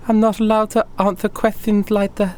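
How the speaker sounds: high, timid refusal; nervous waitress. Acted. Waitress asked where the manager is, answers nervously. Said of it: "clear nervousness in her voice".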